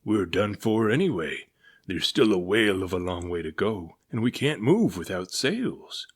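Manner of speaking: captain despairing to his crew; gloomy captain. A narrator's reading. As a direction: grumble the bad news. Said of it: "muttered the captain".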